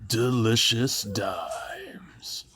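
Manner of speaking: slow, breathy whisper of delicious dimes; slow secretive whisper; whispered catchphrase. Acted. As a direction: draw it out in a hushed whisper.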